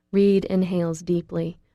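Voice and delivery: ordinary delivery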